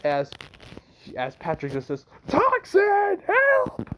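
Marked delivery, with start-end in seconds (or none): 2.3-3.9 very high, loud sustained yell; high-pitched desperate call; cry for help. Acted. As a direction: scream out for rescue. Character cries out for help.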